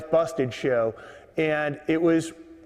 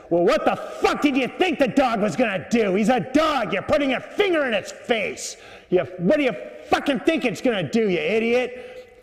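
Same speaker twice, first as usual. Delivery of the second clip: hammed-up irate scolding, pitched up; angry old man; 7 semitones higher than usual. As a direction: play an old man furiously telling someone off. Old man's angry retort to woman.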